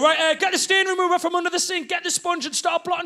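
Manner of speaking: high, urgent livid orders, acted out. Livid dad worried for cream carpets, ordering wife. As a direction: fire off cleanup orders in a high fluster. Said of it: "I'm livid. I'm going".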